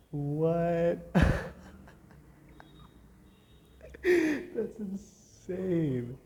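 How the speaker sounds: high, loud, very slow disbelief; stunned delight; amazed. Real, not acted. Amazed again.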